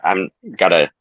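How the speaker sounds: ordinary delivery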